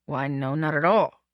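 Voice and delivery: understated, unconvincing denial; hurried denial. A narrator's reading. Man answers woman's guess, mildly hurried. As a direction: deny it so as to imply the opposite. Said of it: "I answered hurriedly".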